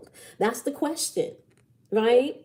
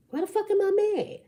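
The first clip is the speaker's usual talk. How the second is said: much higher, fast, exasperated cry; mock exasperated squeal; 12 semitones higher, faster than usual. Shown as a demonstration. Reader asking herself why she's mad, in a high squeal. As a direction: squeal it like you're fed up with yourself. Exaggerated repeat of own question.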